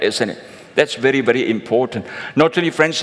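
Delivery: normal talking voice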